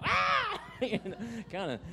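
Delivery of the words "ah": sudden high yelp; a child's shriek of pain from a pinch; mock high scream; retold childhood scream. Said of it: "I screamed out".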